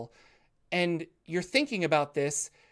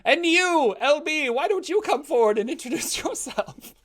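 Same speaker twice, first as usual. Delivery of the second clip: put-on old priest; 8 semitones higher, 4 dB louder than usual. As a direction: bless the crowd like an ancient holy man. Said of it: "priest calls next villager".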